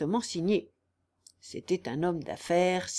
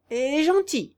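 higher, louder taunt; mocking; 6 semitones higher, 5 dB louder than usual. A narrator's reading. Marsillat sends Raguet away, adding a mocking jibe. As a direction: tease him with mock sweetness. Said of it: "ajouta-t-il d'un air railleur".

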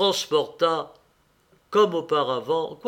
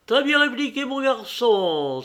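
hearty exclamation; 8 semitones higher, 4 dB louder than usual. A narrator's reading. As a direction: exclaim it high, then stretch the last words. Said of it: "s'écria Bob". (exclamation haute et enthousiaste)

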